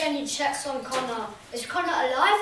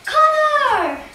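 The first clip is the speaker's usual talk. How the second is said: very high, loud shout; 13 semitones higher, 13 dB louder than usual. Acted. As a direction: cry his name out. Relieved call.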